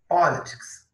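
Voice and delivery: politics said clearly, stress on po; model word to repeat. Shown as a demonstration. Teacher asks students to repeat the word. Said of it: "Vamos repetir a palavra?".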